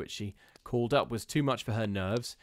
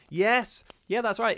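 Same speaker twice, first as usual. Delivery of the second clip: high, loud burst of agreement; real thrill at the chat's guess; excited yes; 11 semitones higher, 8 dB louder than usual. Real, not acted. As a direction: pop up with delighted recognition. Streamer confirms a chat guess.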